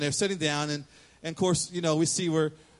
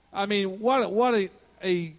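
ordinary delivery

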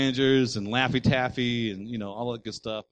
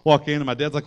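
ordinary talk, nothing marked

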